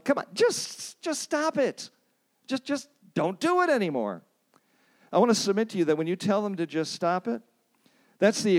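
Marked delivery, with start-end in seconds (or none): 0.0-1.9 much higher, mock exasperated plea; high-pitched mock counselor begging; exasperated pleading. Voicing a useless counselor's advice. 2.5-4.2 much higher, mock exasperated plea; high-pitched mock counselor begging; exasperated pleading. Voicing a useless counselor's advice.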